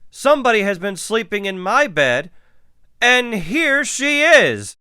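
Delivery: excited little bear shriek; squealed. A narrator's reading. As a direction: squeal it fast, then stretch the discovery. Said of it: "Baby Bear peeked at her little bed and squealed".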